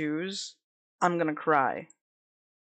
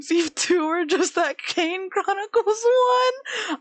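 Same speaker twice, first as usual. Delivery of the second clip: laughing; 11 semitones higher, 4 dB louder, slower than usual. Real, not acted. Laughing aside at chat.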